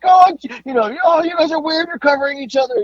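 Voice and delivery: high teasing jeer from the crowd; mocking crowd voice. Crowd teasing two wrestlers covering each other, high mock jeer. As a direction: lift it up high and tease them. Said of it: "and they're like".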